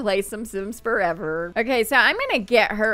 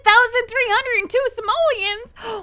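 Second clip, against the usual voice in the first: higher and louder, thrilled announcement; excited; 12 semitones higher, 4 dB louder than usual. Real, not acted. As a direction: cheer over the big payout. Thrilled at the payout.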